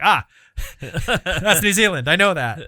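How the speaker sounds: high, loud burst of recognition; pleased outburst, pitched high; delighted recognition. Acted. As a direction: exclaim it through laughter, thrilled to spot it. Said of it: "it's like".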